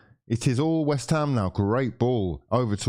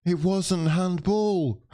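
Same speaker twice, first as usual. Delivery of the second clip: mocking singsong denial; 6 semitones higher, much slower than usual. Mocking the referee's decision.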